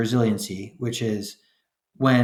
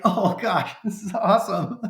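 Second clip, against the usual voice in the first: high, laughing "oh gosh"; delighted laugh; 11 semitones higher than usual. Retold or imitated.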